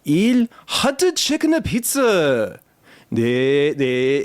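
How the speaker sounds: put-on high, slow, heavy Korean accent. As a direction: lean really hard into a Korean accent. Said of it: "leaned really hard into my best Korean accent".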